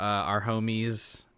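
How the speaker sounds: normal talking voice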